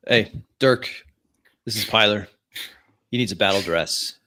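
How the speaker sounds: calling out. Acted. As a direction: call out to the quartermaster. Character calls out to armory officer.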